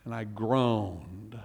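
low, soft and slow; groaning. Acted. Pastor reads the psalm's groan, slow and hushed. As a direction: let the words sink into a groan. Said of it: "reading groan softly".

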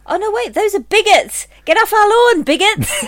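loud high grumpy yelling; mock shouting. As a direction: yell like a cranky old-timer. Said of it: "shouting at them".

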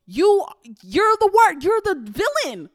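loud and high, the word villain flung out; rising into an indignant shout; heated outburst. Real, not acted. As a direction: call them out hard. Heated rant about defending yourself.

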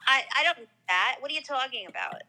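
exaggerated Queens mother, flatly refusing; mock New York mom. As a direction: mimic a dismissive mom in a thick Queens accent. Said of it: "you think she sounds like that".

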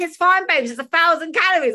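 loud and high, shouting calories across. Retold or imitated. Competitor shouting calories at Joe to keep cover loud. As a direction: call it out loud to cover yourself. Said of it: "I was probably shouting the calories".

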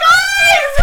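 very high, loud shriek across a room; excited call. Retold or imitated. Friends waving from beside the actor, excited shout. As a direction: squeal it to call friends over. Retells friends calling them over.

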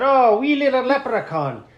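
high, loud lilting leprechaun. Retold or imitated. Entertaining the boy in the car, leprechaun voice. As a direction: put on a playful leprechaun voice. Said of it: "But then I did a voice".

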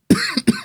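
sharp high cough; coughing fit. Real, not acted. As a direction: cough hard, choking. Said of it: "I'm choking up".

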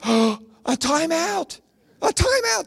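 gasp, then high-pitched incredulity; mock disbelief. Comic scoffing at timeouts for kids, mock shocked.

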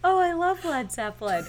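high-pitched earnest gush, imitated; sweetly earnest put-on voice. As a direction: gush like a sweet, eager kid. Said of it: "in, like, such an earnest way".

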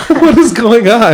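high-pitched, slow words through laughter; laughing bafflement. Real, not acted. Host lost in a co-host's rambling fact, helplessly laughing. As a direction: squeal the question out while cracking up.

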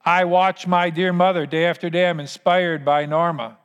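ordinary talk, nothing marked

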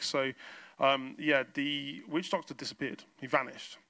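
ordinary talk

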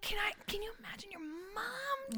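high, soft tearful whisper. Real, not acted. Fan imagines a mother forgetting, tearful. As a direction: squeeze it out softly through tears.

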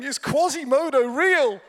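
high, loud, near-laughing cry; comic incredulous outburst. Retold or imitated. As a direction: blurt it out in hammed-up astonishment. Comic outburst retold from his room.

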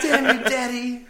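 high, slow, laughing; mock dramatic curse. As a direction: ham up a wronged child.

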